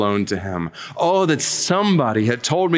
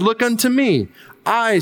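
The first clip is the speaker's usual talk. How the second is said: a hint of an Essex preacher; 9 semitones higher than usual. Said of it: "said he in broad Essex".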